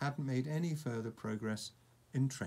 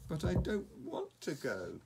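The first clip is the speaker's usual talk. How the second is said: reasonable dragon; 6 semitones higher than usual. Acted. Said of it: "equally reasonably".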